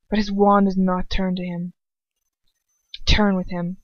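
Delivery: ordinary delivery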